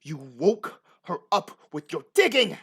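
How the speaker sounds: loud outburst peaking on "digging"; angry accusation. Acted. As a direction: blame them, building to a shout. Farmer accuses players about wife.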